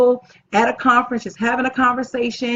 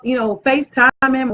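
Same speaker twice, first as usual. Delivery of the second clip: usual speaking voice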